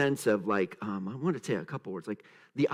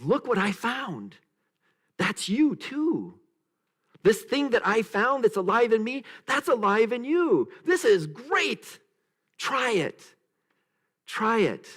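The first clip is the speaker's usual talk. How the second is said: eager sharing voice; 7 semitones higher, 3 dB louder than usual. Retold or imitated. Jesus voiced sharing discovery.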